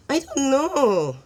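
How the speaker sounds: high, loud, drawn-out shrug; exaggerated slow "dunno"; playful cluelessness. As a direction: stretch it out slowly, high-pitched and teasing.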